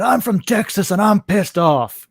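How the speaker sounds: high, slow, hammed-up Texas drawl; Steven, pissed-off Texan. Man imitating Navy classmate beating a vending machine, angry Texan. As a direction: play up the Texas attitude, drawing it out. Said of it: "playing up the Texas, uh, attitude".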